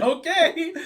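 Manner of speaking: high and loud through real laughter; amused okay, cracking up; laughing okay. Real, not acted. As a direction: blurt it out mid-laugh. Laughing at barber's answer.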